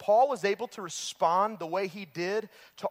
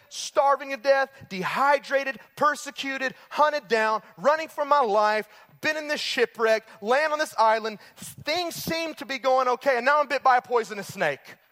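raised, pleading complaint to God, louder and higher; frustrated outburst; 5 semitones higher, 4 dB louder than usual. Pretend. Paul complaining loudly to God about the snake bite, exasperated. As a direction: throw your hands up at God, fed up.

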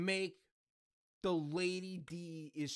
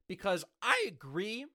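voice cracking up an octave; 2 semitones higher than usual. Real, not acted. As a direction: voice breaks upward on agree. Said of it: "my voice cracked there".

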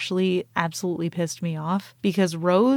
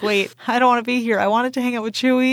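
quick, higher-pitched mock protest; panicky Porg; 4 semitones higher, 3 dB louder, faster than usual. Host voicing the Porg stuck in battle, mock panicked. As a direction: rush it out as a whiny little creature. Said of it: "He's like".

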